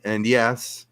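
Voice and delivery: ordinary talk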